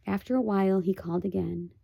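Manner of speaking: ordinary delivery, nothing marked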